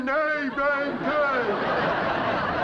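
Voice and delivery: "nay, brown cow" stretched very slowly, laughing; a very slow, laughing try at "nay, brown cow"; struggling pupil. Acted. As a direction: peasant mangling the lesson phrase. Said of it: "It's better".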